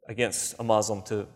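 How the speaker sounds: ordinary delivery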